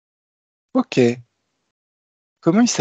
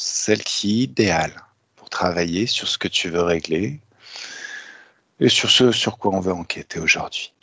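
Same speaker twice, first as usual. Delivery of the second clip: guided hypnosis; 4 semitones lower than usual. Acted. Leads a hypnotic induction.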